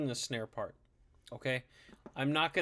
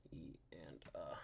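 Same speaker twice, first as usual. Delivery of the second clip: whispering; 8 semitones lower, 16 dB quieter, slower than usual. Real, not acted. Whispering to self while writing.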